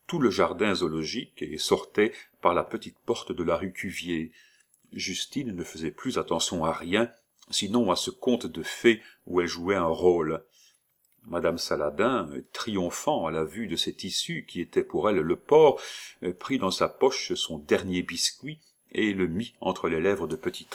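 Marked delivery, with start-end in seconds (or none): none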